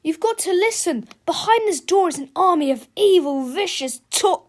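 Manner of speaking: high, pleading warning; urgent cry. A narrator's reading. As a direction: plead to be heard, cut off at the end. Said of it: "cried George".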